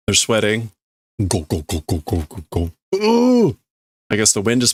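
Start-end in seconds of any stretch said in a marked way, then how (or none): 1.3-2.7 low rumble effect. Acted. Imitating rumbling sound effect. 2.9-3.6 very high rising "Ooh"; whooshing wind cry. Acted. Viewer imitating the wind in the scene, high whoosh.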